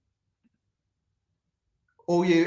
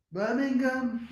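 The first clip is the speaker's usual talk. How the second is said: Birmingham. said higher in a put-on Brummie voice; mock Birmingham accent; 10 semitones higher, slower than usual. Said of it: "You talk like that".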